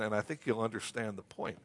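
ordinary delivery

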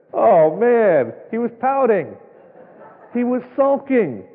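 mock dismay. Real, not acted. Preacher mocking Ahab's pouting.

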